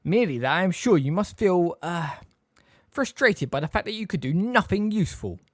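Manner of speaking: high, measured needling with a hesitant "uh"; delicately pointed taunt. A narrator's reading. Snape taunts Sirius as useless, waspishly. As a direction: twist the knife with mock politeness. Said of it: "laid a delicate stress on the word".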